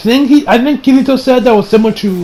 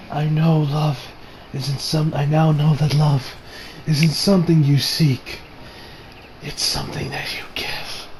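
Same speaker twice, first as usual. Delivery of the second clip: low, hushed quote fading to a whisper; faint, acted farewell; tender dying words; 4 semitones lower, 10 dB quieter than usual. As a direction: voice it softly, with weak pauses. Voices the dying character's farewell.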